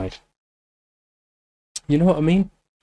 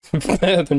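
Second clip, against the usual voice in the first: laughing; 5 semitones higher than usual. Real, not acted. Laughs while talking.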